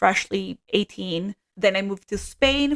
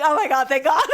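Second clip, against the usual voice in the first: high, laughing mock relief; laughing imitation of relief; relieved tourist; 7 semitones higher, 4 dB louder than usual. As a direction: sigh out the relief, hammed-up. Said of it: "he was like".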